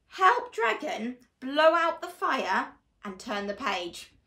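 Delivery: normal talking voice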